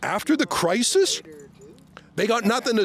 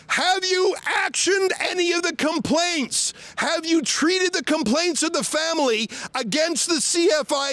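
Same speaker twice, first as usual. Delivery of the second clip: calling out at a parked car, pitched higher; raised voice to be heard through a closed window; outdoors voice; 6 semitones higher than usual. Real, not acted. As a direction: reporter calling out to police officers in a car, raised voice. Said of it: "I'm using my outdoors voice so they can hear me".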